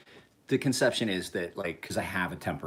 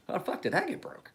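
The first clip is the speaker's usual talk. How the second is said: much higher, incredulous outburst; baffled disbelief; 12 semitones higher than usual. Retold or imitated. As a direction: act stunned at the wreckage. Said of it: "you're like".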